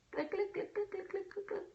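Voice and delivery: tiny, high, soft click noises; imitated clicking. Mimicking someone absorbed in a phone, high soft clicking.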